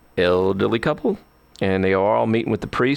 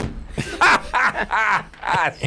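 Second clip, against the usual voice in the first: big laugh; 10 semitones higher than usual. Real, not acted. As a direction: cackle at your own punchline. Laughs at own joke.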